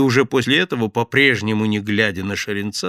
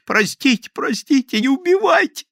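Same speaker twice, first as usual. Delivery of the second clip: shrill, loud hysterical plea; hysterical begging; 6 dB louder than usual. A narrator's reading. Thief on the floor begs the gang for mercy. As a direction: beg for your life in hysterics. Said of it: "забился в истерике".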